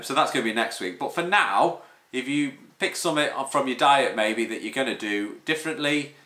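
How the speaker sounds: usual speaking voice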